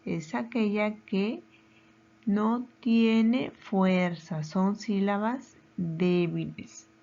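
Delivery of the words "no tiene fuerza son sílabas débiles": slowed right down to show weakness; deliberate slow teacher delivery; slow emphasis. Shown as a demonstration. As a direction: drag out "no tiene fuerza" for the class. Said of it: "Y la sílaba átona".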